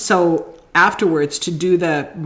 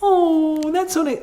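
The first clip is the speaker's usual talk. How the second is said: touched gush; 11 semitones higher, slower than usual. Retold or imitated. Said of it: "I'm like".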